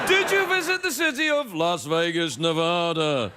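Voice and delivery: lightly done British announcer voice. Pretend. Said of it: "Let's talk like British T V announcers".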